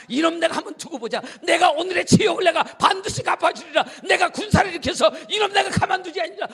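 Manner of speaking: loud, raging threat with a laugh in it; furious vow. Acted. Naaman storming off vows revenge, furious shout.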